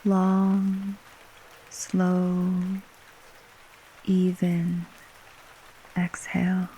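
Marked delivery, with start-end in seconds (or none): none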